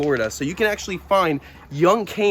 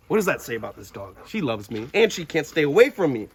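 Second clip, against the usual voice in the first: ordinary talk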